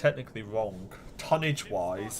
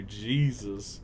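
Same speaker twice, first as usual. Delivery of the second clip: normal talking voice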